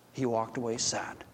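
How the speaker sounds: hushed sad news. Acted. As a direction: let the bad news drop quietly. Messenger gives sad news.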